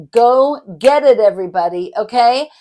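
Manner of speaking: loud, rousing sign-off; loud cheer. Real, not acted. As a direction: pump up the audience. Energetic sign-off to viewers.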